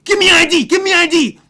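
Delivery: very high, loud, frantic shouting; furious guard. Retold or imitated. Security guard shaking the student for ID, furious yell. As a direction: bark it while shaking him.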